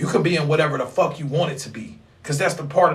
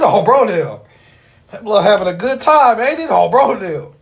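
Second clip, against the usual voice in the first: louder and higher, hyped up; hyped reaction; 6 semitones higher, 7 dB louder than usual. Real, not acted. Streamer hyping a viewer having a good time, loud and excited. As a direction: cheer the guy on like he is killing it.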